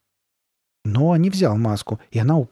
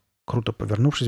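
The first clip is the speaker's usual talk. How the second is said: usual speaking voice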